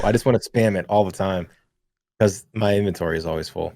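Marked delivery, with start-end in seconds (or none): none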